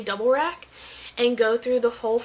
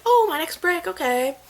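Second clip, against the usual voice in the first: higher, louder mock surprise; mildly surprised; 7 semitones higher, 5 dB louder than usual. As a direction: act out a pleasant little realization. Retells noticing break time had come.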